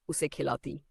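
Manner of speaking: plain reading voice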